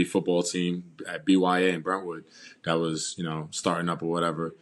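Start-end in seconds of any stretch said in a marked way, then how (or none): none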